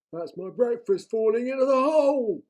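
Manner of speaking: the troll pleased with his plan; scheming troll. A narrator's reading. Troll voice in read story.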